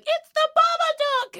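high, loud mock child yell; hammed-up kid shrieking the answer; frantic little boy. Son blaming the monster to mum, high mock yell. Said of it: "And he's like".

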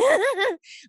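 imitated high laugh from the back of the throat; short, high put-on throaty laugh; high-pitched raspy laugh. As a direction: mimic a star's high, raspy giggle. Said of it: "like, high pitch, kinda, like, raspy in the back of her throat".

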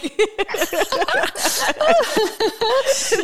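a long, high, real burst of laughter; cracking up at her own joke. Real, not acted. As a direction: let out a big genuine laugh.

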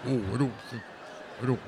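low, dopey man impression; mocking man's voice. Wife to husband, mocking his low dopey voice. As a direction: play the whiny boyfriend, low. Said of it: "You go".

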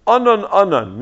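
Anan, Anan called out loud and high, each falling; a pointed slight, name said twice; curt double name. Shown as a demonstration. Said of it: "Say his name twice".

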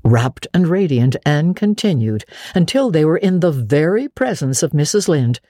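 usual speaking voice

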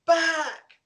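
high, drawn-out calling command; hauling into it. Shown as a demonstration. Handler sending dog three hundred yards, hollered. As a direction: send the dog far with a high-pitched holler. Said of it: "if I haul into it".

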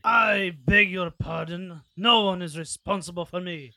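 loud, haughty outrage; indignant noble. Acted. Offended noble in character.

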